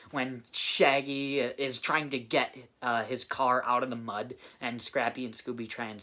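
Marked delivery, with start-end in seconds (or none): none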